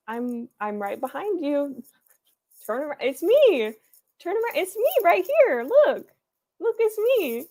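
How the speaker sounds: high, loud, urgent plea to be seen; playful calling out. Pretend. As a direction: call out excitedly to someone who won't turn round. Acts calling out to viewer.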